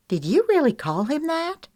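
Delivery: high, startled disbelief; shocked. A narrator's reading. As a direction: gasp it out in shock. Lexi shocked, far timbre.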